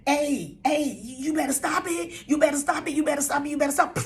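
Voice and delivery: rising, loud warning about to burst; high, insistent acted outburst brewing; boiling over. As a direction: repeat it urgently, pressure building. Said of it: "like a pot that's boiling over".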